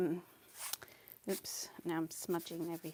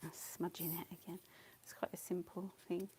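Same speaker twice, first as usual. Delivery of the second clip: whispering; 8 dB quieter than usual. Real, not acted. Muttering to self.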